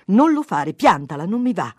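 loud, irritated refusal; annoyed protest. A narrator's reading. Husband having his nose squeezed, annoyed protest.

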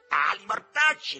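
high, drawn-out indignant shout; cry out. A narrator's reading. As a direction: cry out the curse, stretching it. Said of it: "gridò". (grido acuto e strascicato)

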